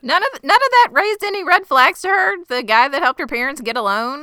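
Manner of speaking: high disbelieving question; can you believe this; incredulous. Real, not acted. Co-host reacting to the plot, high and incredulous.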